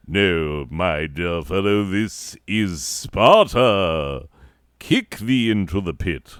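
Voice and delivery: stuffy British Greek hero, drawn-out SPARTA; mock battle cry in posh British; stuffy British accent. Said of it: "that's how we do Greeks and Romans".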